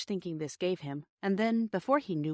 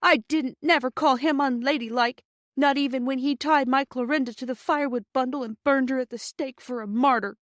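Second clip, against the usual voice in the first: tearful complaint; 7 semitones higher, 6 dB louder than usual. Acted.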